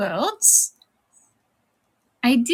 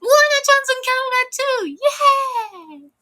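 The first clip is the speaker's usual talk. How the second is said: excited cheer. Real, not acted. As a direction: squeal it out as a happy shout-out. Cheering along for Canada.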